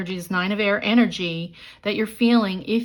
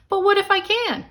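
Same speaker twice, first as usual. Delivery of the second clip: "But what if I can?" much higher and louder; excited what if; 13 semitones higher, 5 dB louder than usual. Shown as a demonstration. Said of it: "Say it".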